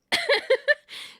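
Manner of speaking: short high giggling burst; burst of laughter. Real, not acted. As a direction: laugh out loud at the silly nicknames. Laughs at dog nicknames.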